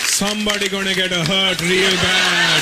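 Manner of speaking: put-on heavy Indian-accented dad threat; dad's threat. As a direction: drag out real with menace. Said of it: "That was my dad's threat".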